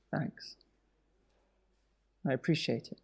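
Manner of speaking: quiet thanks. Acted. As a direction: murmur your thanks, subdued and moved. Quiet gratitude after offer accepted.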